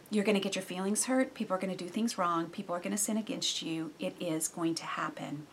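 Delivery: ordinary talk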